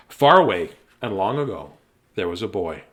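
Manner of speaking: storybook voice; story narration. Acted. Reads the story aloud.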